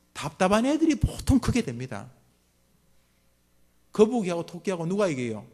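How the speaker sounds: ordinary talk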